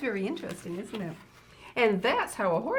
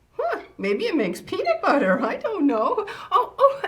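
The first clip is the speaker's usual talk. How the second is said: laughing; 10 semitones higher, 9 dB louder than usual. Real, not acted. Puzzling over contraption, laughing.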